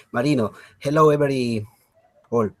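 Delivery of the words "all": normal talking voice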